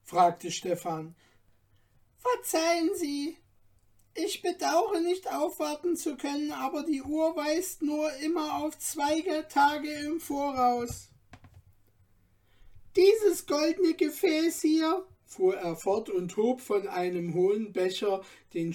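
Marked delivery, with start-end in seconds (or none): 2.2-11.1 high, eager little dwarf guide; courteous little dwarf. A narrator's reading. Dwarf apologises politely. 13.0-15.0 high, louder, lively dwarf telling fortunes; lively little dwarf. A narrator's reading. Dwarf presents the goblet.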